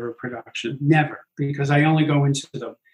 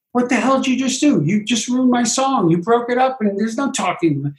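higher, fast indignant outburst; retold outrage, much higher and quick; mock outrage; 7 semitones higher than usual. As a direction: play an offended singer protesting. Cindy outraged at the staging.

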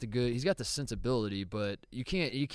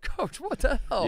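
high, drawn-out mock dismay; retold dismay; 11 semitones higher than usual. Player reacting to coach's remark, mock dismay. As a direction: stretch it out, pitched way up in disbelief.

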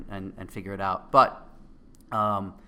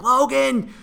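frantic gym scream, much higher and louder; 11 dB louder than usual. Retold or imitated. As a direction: scream him through the last rep. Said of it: "I started really screaming".

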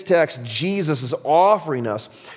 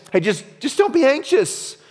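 high-pitched, hammed-up carefree advice; mock glib advice; 10 semitones higher than usual. Mocking unhelpful advice about anxiety.

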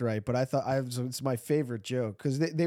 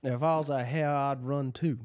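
mock Southern drawl; 2 semitones lower than usual. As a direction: do the historian's Southern accent for laughs. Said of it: "he had, like, a southern drawl".

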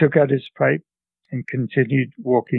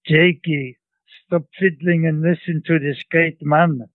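put-on grandmother scolding her grandson in a Central European accent, higher; grandmother's Central European accent; 4 semitones higher than usual. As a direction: tell the boy off in a thick Central European accent. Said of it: "imitate my grandmother, uh, my grandmother's, uh, English".

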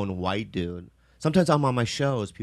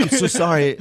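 put-on dropped-accent apology; 6 semitones higher, 3 dB louder than usual. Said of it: "the accent fell out".

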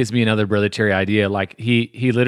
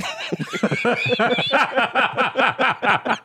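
long high laugh; 13 semitones higher than usual. Real, not acted. Laughing at the huge watch.

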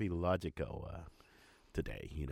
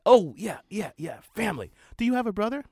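eager son; 11 semitones higher, 5 dB louder than usual. Retold or imitated. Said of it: "He said".

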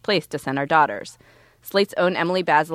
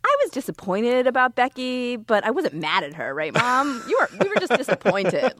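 mock little boy, pitched higher; child voice; 7 semitones higher than usual. Son coaxing his mom not to be mad, higher childlike voice. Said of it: "he just kept saying to me".

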